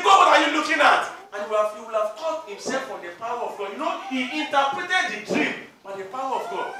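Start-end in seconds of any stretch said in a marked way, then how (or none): 0.0-1.1 higher, louder angry challenge; offended outburst. Acted. Offended Joseph challenging God, angry shout. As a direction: hurl it at God.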